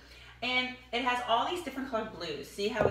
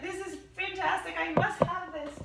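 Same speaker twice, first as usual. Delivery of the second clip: high, thrilled gush; gushing friend; 8 semitones higher than usual. Retold or imitated. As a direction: rave about it like a delighted friend. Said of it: "She's like".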